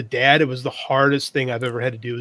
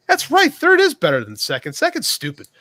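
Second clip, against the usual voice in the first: high, louder, gleeful agreement; a happy kid parroting his dad's lesson; eager kid echo; 11 semitones higher, 5 dB louder than usual. Retold or imitated. Son happily repeating dad's rule, high and eager.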